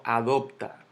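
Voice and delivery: "adoptar" stressed on DOP; misplaced stress. Shown as a demonstration. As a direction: lean on the middle syllable of "adoptar", as a wrong example. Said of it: "Yo no digo".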